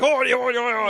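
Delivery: loud, high fake French ranting; mock French rant. Chewing the driver out in mock French, loud. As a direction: rant like an angry Frenchman, shouted. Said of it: "chew Ocon out in French".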